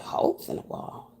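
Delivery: whispered, disbelieving question; stunned whisper. Retold or imitated. To her baby daddy, whispering in disbelief. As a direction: breathe it out in shock.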